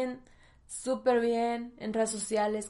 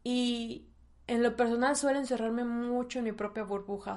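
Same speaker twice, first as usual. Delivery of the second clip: normal talking voice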